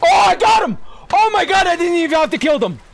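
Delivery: high, loud burst of triumph; elated shout. Real, not acted. Player celebrating an unexpected kill, elated shout. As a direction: shout it in delighted disbelief.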